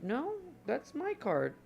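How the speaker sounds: high, slow, disbelieving; surprised squeak. Real, not acted.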